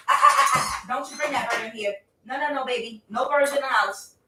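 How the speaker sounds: high-pitched urgent no-no to a cat; real pleading scold; scolding a pet. Real, not acted. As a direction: shoo the cat back in a high voice. Scolding cat carrying a bird.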